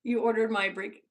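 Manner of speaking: ordinary talk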